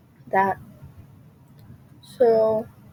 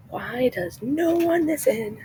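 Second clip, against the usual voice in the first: high, drawn-out theatrical plea; plaintive lament; 8 semitones higher, 3 dB quieter, slower than usual. Acted. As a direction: stretch it out slowly, pitched way up, like a dramatic complaint. Voicing character's Icarus speech plea.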